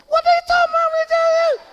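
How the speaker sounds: very high, loud indignant squawk; mock attitude. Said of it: "That's when you get an attitude. You start talking".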